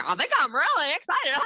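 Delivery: high, loud excited impression with laughter; Brooke Porch. Recruiter mimicking her excited friend, high giggly impression. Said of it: "It's my best impression".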